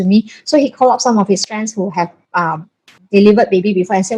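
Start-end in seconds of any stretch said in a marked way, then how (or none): none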